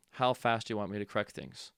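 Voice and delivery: ordinary talk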